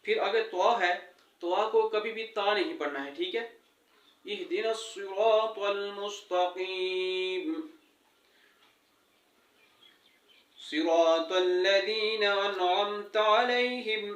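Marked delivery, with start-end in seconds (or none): 4.3-14.0 slow, drawn-out tajweed recitation; Quran recitation. Shown as a demonstration. Teacher reciting Fatiha to students, slow and measured. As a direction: recite each word slowly and carefully.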